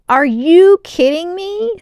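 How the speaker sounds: high, loud delighted disbelief; overjoyed and amazed, slowly; thrilled. A narrator's reading. Delighted by new outfit.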